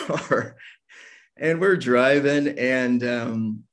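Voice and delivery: ordinary talk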